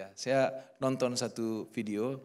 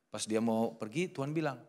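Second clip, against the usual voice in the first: ordinary talk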